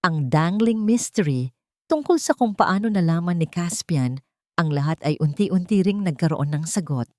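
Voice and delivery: ordinary delivery, nothing marked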